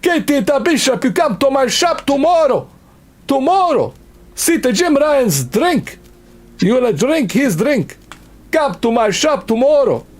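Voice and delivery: high, heated wiseguy rant; mock Italian-American. Mobster shopkeeper ordering Katie to his shop, heated. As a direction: play the pushy mobster shopkeeper.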